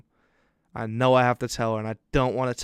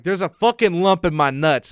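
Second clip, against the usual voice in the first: louder, higher pitched blurt; retold outburst; 5 semitones higher, 8 dB louder than usual. Son confessing to his mom about a lump, exasperated outburst.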